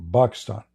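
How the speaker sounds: Pakistan said as "Bakistan", P turned to B; Arabic pronunciation. Shown as a demonstration. Showing viewers how Arabs say Pakistan, B for P. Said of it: "We don't have P".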